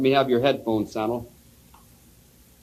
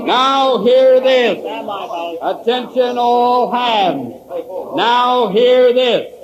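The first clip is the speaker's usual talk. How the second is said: ship's announcement; 3 semitones higher, much slower than usual. Acted. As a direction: draw out each word like a shipboard announcement. Bosun's call over intercom.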